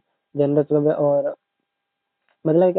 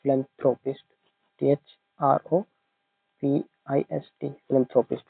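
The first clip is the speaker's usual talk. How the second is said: letters given one by one with pauses; spelling dictation; 4 dB quieter, slower than usual. Shown as a demonstration. Teacher dictating the spelling to students. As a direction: spell it out slowly for them. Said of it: "philanthropist भी लिख लेना".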